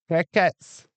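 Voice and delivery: badly faked Welsh lilt; terrible Welsh accent. Streamer joking that nobody laughed, in a bad Welsh accent. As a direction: put on a poor Welsh accent. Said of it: "that is a terrible Welsh accent".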